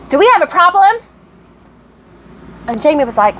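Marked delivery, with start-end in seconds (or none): 0.1-1.0 high, pushy challenge in imitation; mock confrontational. Susan phones the woman helping her, mock confrontational. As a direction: put on a testy caller's voice, pitched up.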